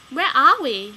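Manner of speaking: loud, alarmed cry. Acted. Kid cries out lost in the comic crowd. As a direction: call it out in confusion. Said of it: "you cry".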